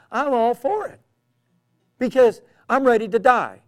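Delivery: high, loud, ringing declaration; bold defiance. Retold or imitated. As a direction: preach it out with no fear of death. Preacher defies a threatening man.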